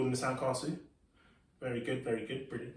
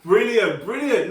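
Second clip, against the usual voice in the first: ordinary talk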